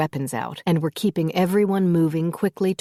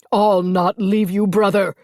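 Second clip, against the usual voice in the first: louder defiant bellow; stubborn battle shout; 4 semitones higher, 6 dB louder than usual. A narrator's reading. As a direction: brother refusing to leave. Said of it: "Archie bellowed".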